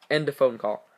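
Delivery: usual speaking voice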